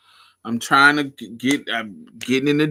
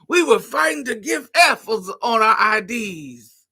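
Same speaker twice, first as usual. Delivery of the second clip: slow, much higher, loud granny; old lady voice; 11 semitones higher, 6 dB louder than usual. Pretend. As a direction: preach like a cranky old lady. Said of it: "I wanna be talking shit".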